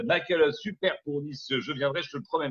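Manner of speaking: usual speaking voice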